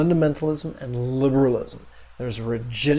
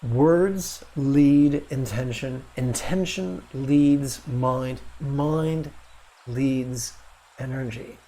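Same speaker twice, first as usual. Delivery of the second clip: slow recitation; slower than usual. Shown as a demonstration. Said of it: "there's a wonderful saying".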